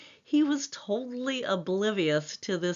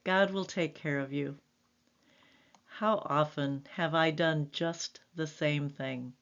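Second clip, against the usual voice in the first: ordinary delivery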